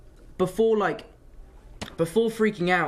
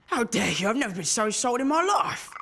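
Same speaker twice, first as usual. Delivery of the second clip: mock outrage; 5 semitones higher than usual. Mock outrage in staged bit.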